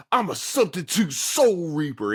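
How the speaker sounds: high, punched-out announcement; mock proud proclamation. Ichigo reveals himself to Saito, mock proud proclamation. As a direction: blurt it out like a hammed-up boast.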